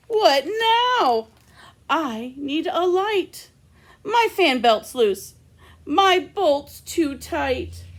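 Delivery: petulant little robot; whiny robot. Acted. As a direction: list the complaints like a fussy robot. Robot complaints.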